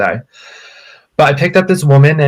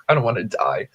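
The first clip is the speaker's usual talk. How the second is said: quiet mock fear; 7 dB quieter than usual. Scared thought in car.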